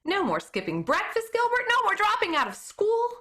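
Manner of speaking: growing thrill of a new idea; excited. A narrator's reading. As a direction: let the excitement lift the voice. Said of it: "I began to get excited".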